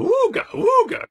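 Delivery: very high, loud klaxon noise; imitated alarm bell. Warning bells go off while reading, imitated alarm. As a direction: hoot like a warning siren. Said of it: "sort of alarm bell started".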